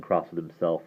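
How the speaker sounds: usual speaking voice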